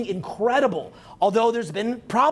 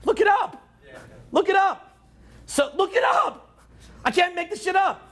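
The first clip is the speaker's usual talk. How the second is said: high, pushy repeated insistence; insistent rant; 7 semitones higher than usual. Retold or imitated. Canadian daring Americans to check facts, high insistent. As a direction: jab each look it up higher. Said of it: "I'm sitting up here".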